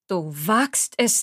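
slow, ominous angered elder; menacing slow rebuke; deep angry voice. A narrator's reading. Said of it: "Die Stimme meiner Großmutter war tief und klang verärgert".

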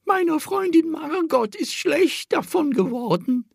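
put-on high, thin old lady voice; reedy little old woman. A narrator's reading. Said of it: "mit dünner Stimme".

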